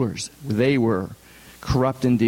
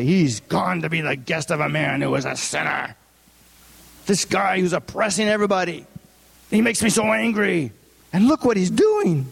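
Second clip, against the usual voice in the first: mock outrage, rising as it goes; grumbling; 3 semitones higher than usual. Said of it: "They grumbled".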